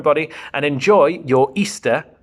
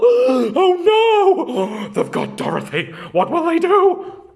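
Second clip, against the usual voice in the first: 1 alarmed gasp; 10 semitones higher, 4 dB louder, slower than usual. Acted. Friends gasp, Dorothy captured.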